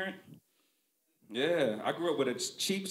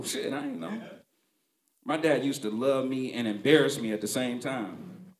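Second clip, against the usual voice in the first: ordinary delivery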